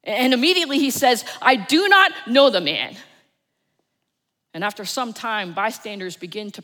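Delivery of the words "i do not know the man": loud, stressing each word, NOT hit hard; emphatic denial. Acted. Peter denying Jesus to the others, loud and emphatic. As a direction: slam down the denial.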